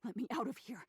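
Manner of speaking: cry for help. Acted. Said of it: "you cry".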